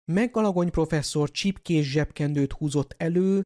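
ordinary delivery

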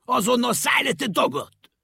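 high, loud angry yell; furious driver shouting at a stowaway. A narrator's reading. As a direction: bark him off the truck. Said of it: "Rákiáltott mérgesen".

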